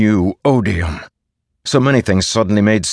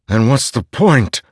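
higher-pitched desperate plea; begging; 6 semitones higher, 4 dB louder than usual. A narrator's reading. Dalinar begs the voice for meaning, desperate. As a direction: plead for an answer. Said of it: "Dalinar begged".

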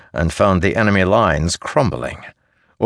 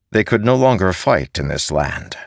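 ordinary delivery, nothing marked

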